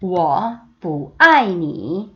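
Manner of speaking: very slow, each syllable drawn out; slow choral reading; slow read-along. Shown as a demonstration. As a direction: read slowly with the class. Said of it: "한 번 더".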